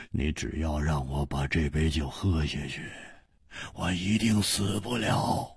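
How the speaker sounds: a dying friend's gentle reassurance; tender, soft. Acted. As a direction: comfort him quietly. Hu Tiehua softly comforts friend.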